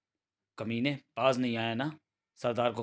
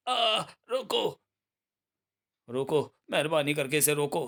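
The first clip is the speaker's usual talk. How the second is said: high drawn-out cry easing into a plea; pained begging; 4 semitones higher than usual. Acted. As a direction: beg through the pain of the whip, pitched up. Whipped bandit begs for it to stop. (दर्द में ऊँचे सुर की गुहार)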